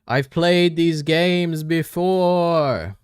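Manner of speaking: high, loud, very slow hammed-up dub actor; imitating the Squid Game English dub; mock English dub line. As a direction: drag it out like a cheesy dub voice. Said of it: "the one thing I've seen from the Squid Game dub".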